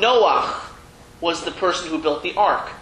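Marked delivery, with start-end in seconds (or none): 0.0-0.6 Noach ending in a throaty ach; teacher gives the Hebrew Noach. Shown as a demonstration. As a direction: say Noach with a guttural ch, not Noah. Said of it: "the way it's said in Hebrew".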